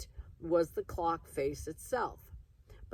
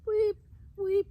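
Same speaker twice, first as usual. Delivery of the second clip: very high, loud squeaky weep; protesting bird chirp; 8 dB louder than usual. Acted. Broken clock bird's last protest, very high squeak. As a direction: squeak out the dying weeps. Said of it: "at last protesting".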